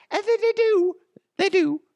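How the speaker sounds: loud, very high squeaky answer; silly high voice. Pretend. Host answering own question in the ad, silly high voice.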